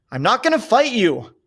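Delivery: high, loud refusal; shouted refusal. A narrator's reading. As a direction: shout it back firmly. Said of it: "Cole shouted".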